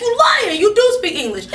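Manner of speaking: high, loud call-out; indignant. Retold or imitated. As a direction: catch them in the lie. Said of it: "I'm like".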